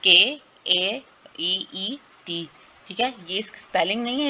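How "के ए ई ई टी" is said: के, ए, टी said slowly, one sound at a time; slow phoneme-by-phoneme sounding out; separate phoneme sounds. Shown as a demonstration. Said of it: "ये इसका sound है".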